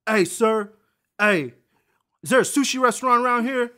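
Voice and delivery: loud, high-pitched mock holler; imitating ignorant customer. Customer asking a store clerk for directions, mocked loudly. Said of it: "how fucking ignorant can you sound".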